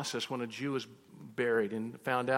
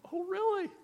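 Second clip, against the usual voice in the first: much higher, mock-surprised oh really. Pastor feigning surprise to win over the caller, much higher. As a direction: sell the surprise, way up high.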